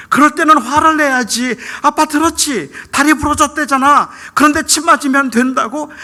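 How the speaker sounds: high, loud outburst of a hurting father; impassioned yelling. Retold or imitated. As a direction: shout at your son, pleading with him to get mad. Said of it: "저도 모르게 그냥 소리를 질렀습니다".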